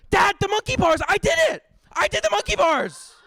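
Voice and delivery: put-on high, loud triumphant kid's cry; excited lie. Boy lying to his dad about the monkey bars, loud high triumphant cry. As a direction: shout it like a kid claiming a victory. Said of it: "And I go".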